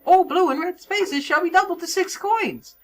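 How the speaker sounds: high, squeaky announcer voice; Toad. Acted. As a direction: proclaim the rules in a high cartoon voice. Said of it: "That's probably a less annoying Toad voice".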